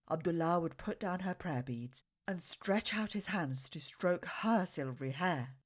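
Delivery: ordinary delivery, nothing marked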